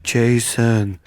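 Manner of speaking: low, godly heckle. Retold or imitated. Comic imitating a heckler playing God mid-set, low godly voice. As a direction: intone it low like God from the sound box. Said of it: "heckle you as, as God".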